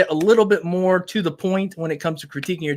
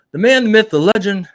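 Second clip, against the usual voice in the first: louder, higher ring-announcer build-up to the name; hype announcer intro; 5 semitones higher, 6 dB louder than usual. Acted. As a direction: introduce him like a boxing champ. Hypes co-host like an announcer.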